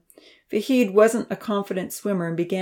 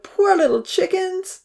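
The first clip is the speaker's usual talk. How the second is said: put-on high, slow child's voice, sorry for them; pitying; 12 semitones higher, slower than usual. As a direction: feel sorry for the chickens. Charlie pitying the chickens.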